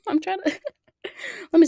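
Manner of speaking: giggling through the words; laughing. Real, not acted. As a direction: laugh while talking. Laughing at own arm joke.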